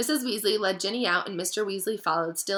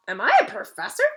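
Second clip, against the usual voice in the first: mild surprise; 6 dB louder than usual. A narrator's reading. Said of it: "in mild surprise".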